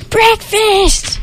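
high screeching troll. Acted. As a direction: screech it like a shrill little troll. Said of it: "screeched a troll".

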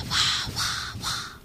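soft unvoiced wah; bat wing flutter. A narrator's reading. The little bat flying overhead, whispered flutter. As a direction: whisper the wingbeats. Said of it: "sound from the bat's quick wings".

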